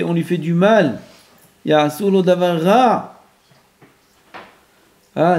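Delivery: ordinary talk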